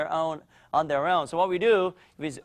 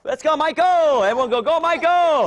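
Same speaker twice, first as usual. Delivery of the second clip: shouted cheer; 9 semitones higher, 7 dB louder than usual. Real, not acted. Coach and class cheering Michael on, shouting.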